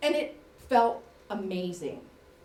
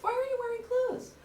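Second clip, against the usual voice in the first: put-on child's voice; 13 semitones higher, faster than usual. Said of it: "the youngest child says to me".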